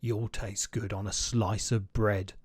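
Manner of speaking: low, slow, menacing monster; hungry Gruffalo. Acted. Gruffalo threatening the mouse, low and slow. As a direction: lick your lips over the mouse.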